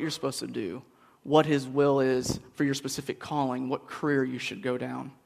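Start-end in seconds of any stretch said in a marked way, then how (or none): none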